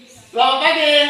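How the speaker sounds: "Selamat pagi" called out high and loud; called greeting. Shown as a demonstration. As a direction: sing out the greeting to the class. (salam "Selamat pagi" diserukan tinggi dan keras)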